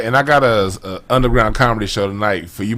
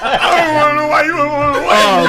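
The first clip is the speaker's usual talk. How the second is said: high-pitched, giggling quip; giggling tease about a friend; laughing high joke. Real, not acted. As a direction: toss it off while laughing.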